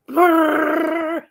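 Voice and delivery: put-on boys' rolled-R car rumble, "Brrr"; rolled R engine noise. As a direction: trill "Brrr" like a revving car. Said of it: "they always sat there with their cars, went".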